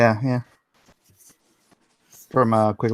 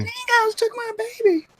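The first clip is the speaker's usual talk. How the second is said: hammed-up high Australian wail, dingoes took my baby; mock Aussie movie line. Woman mocking the movie's famous line, high mock wail. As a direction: parody the movie mother's cry. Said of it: "She's making fun of the movie".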